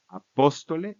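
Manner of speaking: "apóstole" stressed on PÓS; antepenultimate stress. Shown as a demonstration. Teacher showing the vocative, stress on PÓS in "apóstole". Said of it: "Regresa a la posición que tenía".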